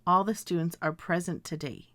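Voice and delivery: "present" stressed on first syllable; present as PREZ-ent. Shown as a demonstration. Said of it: "I would say".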